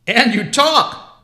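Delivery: slow, loud, high astonishment; amazed Santa. Acted. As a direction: marvel at a talking bear.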